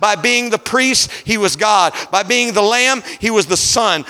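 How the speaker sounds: climbing sermon cadence over the organ; rising pulpit fervor, held strong; preaching at full force. Acted. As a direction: preach it home to the congregation. Preaching to congregation with music.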